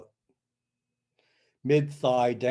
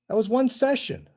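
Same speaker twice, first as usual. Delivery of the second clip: higher, louder burst of wonder; amazed; 12 semitones higher, 7 dB louder than usual. Real, not acted. Healer telling the host about the cure, amazed. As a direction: marvel at it right after laughing. Marvelling after laughing at story.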